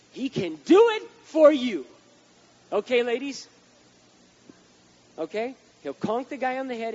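very loud, slow, high punch; preaching shout. Real, not acted. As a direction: hit the promise hard.

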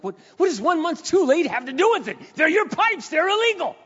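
furious homeowner shouting at the insurer; outraged shout. Retold or imitated. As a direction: yell it at the claims man. Claim rejected, outraged reply.

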